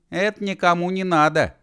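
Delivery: high-pitched brush-off; dismissive call. Acted. Girl to running Mashkov, high dismissive call. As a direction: reject it, pitched well up.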